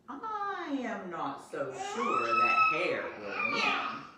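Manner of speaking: a lightly voiced wise old owl, slow; slow and knowing, a hint of old owl; deep wise owl. A narrator's reading. Owl doubting Hare before the race, lightly voiced wise slow owl. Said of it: "said in his deep, wise voice".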